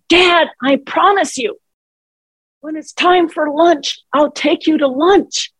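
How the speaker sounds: high-pitched exasperated promise, retold; retold frustration. Daughter retelling her outburst at forgetful dad, frustrated. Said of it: "I raised my voice. I got frustrated".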